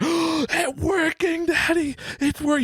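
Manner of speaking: imitating a thrilled small child, very high; high, drawn-out squeal of delight to Daddy; so excited. Son hears the coins clanging, high excited imitation. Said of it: "He's so excited".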